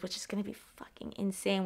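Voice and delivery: breathy whispered aside. Real, not acted. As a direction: drop to a hushed whisper, as if sharing a secret. Hyped aside about line combos.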